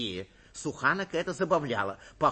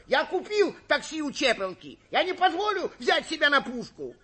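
high-pitched frantic protest; shouting; 12 semitones higher, 4 dB louder than usual. A narrator's reading. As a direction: cry out your denial at high pitch. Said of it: "кричал Суханок". (кричать, протестуя, высоким голосом)

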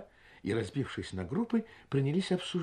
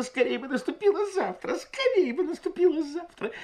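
higher, eager repetition in a woman's voice; eager repeating. A narrator's reading. Guest keeps repeating to the salon, high and impatient. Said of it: "беспрерывно твердила".

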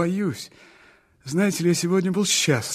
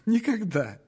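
a little laugh through the word; 5 semitones higher than usual. Acted. Dreamer laughing at his own loneliness. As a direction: laugh it off shyly.

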